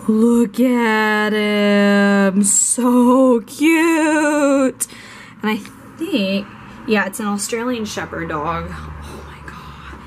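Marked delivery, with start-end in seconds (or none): 0.1-4.9 gushing over puppy. Real, not acted. Vlogger shows photo of puppy. 8.7-10.0 breathy slow whispered gasp of delight; awed whisper. Real, not acted. Vlogger whispers in awe at the puppy photo.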